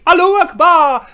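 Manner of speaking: high, loud mocking proclamation; exaggerated mock fervor; mock battle cry. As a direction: shout it like a zealot's cheer.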